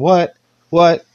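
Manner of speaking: loud, high, punched whats; Stone Cold whats. Retold or imitated. Imitating Stone Cold answering everything, loud high whats. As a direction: fire each what back. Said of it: "how Stone Cold would do it".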